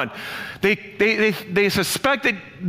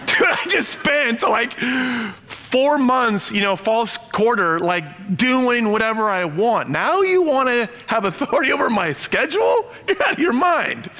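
higher, laughing indignant rant; mock outrage; 6 semitones higher than usual. Son ranting at mom about his curfew, laughing mock outrage. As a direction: play up the teen outrage with a laugh.

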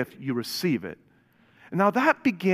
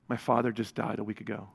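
low, soft, sober quote; subdued retold confession; 6 semitones lower, 7 dB quieter than usual. Preacher retells a stranger confiding his loss, subdued. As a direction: quietly relay the man's loss.

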